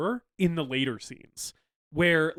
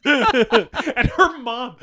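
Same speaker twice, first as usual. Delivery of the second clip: laughing; 9 semitones higher, 10 dB louder, much slower than usual. Real, not acted. Laughing while starting a story.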